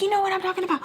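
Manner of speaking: very high, irritated imitation; mock a fed-up woman snapping back; pissed off. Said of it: "she's, like, super pissed off".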